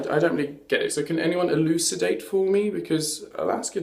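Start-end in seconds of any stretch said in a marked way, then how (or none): none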